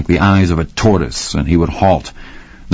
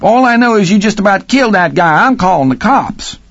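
angry cook; 14 semitones higher than usual. A narrator's reading. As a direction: threaten to call the police. Cook threatening.

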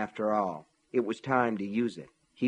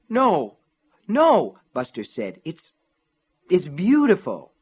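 eager protest; 6 semitones higher, 8 dB louder, slower than usual. Acted. Buster protests to Gaunt.

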